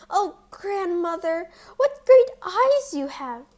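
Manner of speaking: put-on high, loud astonished child voice; amazed at grandmother's ears; wondering girl. A narrator's reading. Girl questions disguised wolf.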